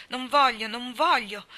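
loud, high, vehement protest; loud distraught insistence; desperate refusal. A narrator's reading. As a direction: cry out the refusal, frantic. Distraught Malli's repeated refusal.

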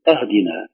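أهدنا opened on a: "ahdina"; wrong fatha opening. Shown as a demonstration. Teacher quoting a common error, أهدنا said ahdina. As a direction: start أهدنا with a in place of i. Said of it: "البعض يقول".

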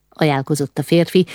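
ordinary delivery

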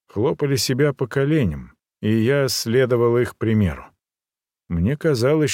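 usual speaking voice

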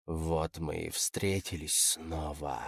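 very soft, low, slow purring voice; low panther purr. A narrator's reading. Rescuer over the captive's shoulder, low purring murmur. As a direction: purr it slowly, close by the ear. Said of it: "раздался низкий голос, звучащий как урчание пантеры".

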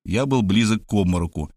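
ordinary delivery

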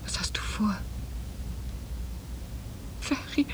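scared hush; anxious whisper. Acted. Uneasy wife asks husband his plan, anxious whisper. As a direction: breathe the question, uneasy.

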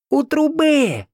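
very high, drawn-out yell; yelled answer. A narrator's reading. Mother-in-law answering the rescuer, high yell. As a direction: yell it over to someone far away. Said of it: "заорала тёща".

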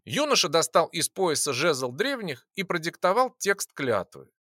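ordinary delivery

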